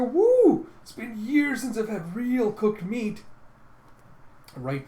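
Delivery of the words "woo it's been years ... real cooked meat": high, loud whoop of hungry delight; whoop, then stretch out the craving slowly; excited whoop. Retold or imitated. Hungry man sees real cooked meat, excited whoop. Hungry diner exclaims over meal.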